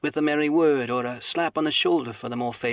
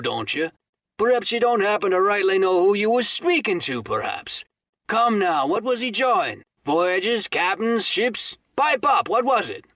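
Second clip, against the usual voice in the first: high, loud hectoring; badgering landlord; 7 semitones higher, 6 dB louder than usual. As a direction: fire questions at him. Silver badgers Morgan.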